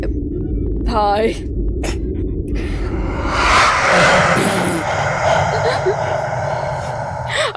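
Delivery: breathy wheezing laugh; long breathy laugh. Real, not acted. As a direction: laugh helplessly.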